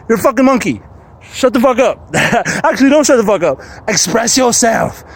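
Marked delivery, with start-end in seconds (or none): none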